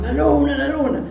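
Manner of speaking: high-pitched mock cry, not full volume; imitated crowd shouting. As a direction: imitate excited brethren calling out. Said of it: "empezaron a gritar los hermanos".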